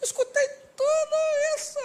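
very high, whiny fake crying; exaggerated tearful whimper; mock hurt. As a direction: squeal it out like a hammed-up sob. Mock-hurt at the insults he heard.

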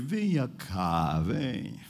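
low, slow beckoning; frail sick woman. Retold or imitated. Sick sister calls the visitor near, low and weak. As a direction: imitate a weak woman calling him closer. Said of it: "Ela fez".

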